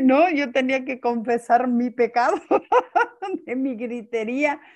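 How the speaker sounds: laughing through the words; a real laugh as she admits it. Real, not acted. Laughs confessing her yelling. (riéndose al confesar)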